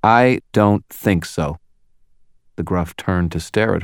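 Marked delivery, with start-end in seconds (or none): 0.0-1.6 slow, firm, loud challenge; loud challenging tone. A narrator's reading. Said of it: "in a very clear, loud, challenging tone".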